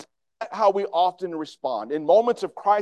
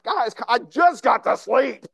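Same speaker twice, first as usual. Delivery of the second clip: put-on whine of someone just woken, higher and louder; mock groggy voice; 5 semitones higher, 5 dB louder than usual. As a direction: play a grumpy sleeper roused too soon, hammed up. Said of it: "Yeah, a groggy voice".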